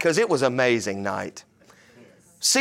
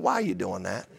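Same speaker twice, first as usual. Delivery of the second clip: soft, lower, absurdly gentle question; mock mild; 8 semitones lower, 7 dB quieter than usual. Pastor mocks a gentle reply to a car thief, soft and mild. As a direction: ask the car thief as if it were nothing.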